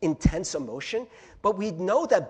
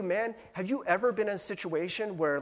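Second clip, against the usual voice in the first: usual speaking voice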